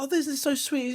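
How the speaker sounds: high, sweet coo; cooing girlfriend. Retold or imitated. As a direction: gush like she found a puppy. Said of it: "It's like".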